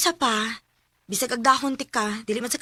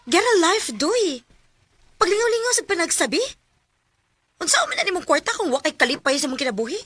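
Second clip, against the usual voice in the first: louder, higher mocking jab; angry retort; 7 semitones higher, 6 dB louder than usual. [name] mocking Dodoy during their quarrel, louder. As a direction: throw it back at him in scorn. Couple quarrel about drinking.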